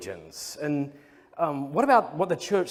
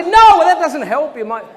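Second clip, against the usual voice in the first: much higher, loud frantic retort; mock panic; 12 semitones higher, 15 dB louder than usual. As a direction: insist to Gareth he still might not exist. Telling Gareth proof doesn't help.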